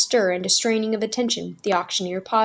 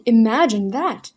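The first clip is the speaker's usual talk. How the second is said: excited; 3 semitones higher, 7 dB louder, slower than usual. A narrator's reading. Woman marveling at dolls.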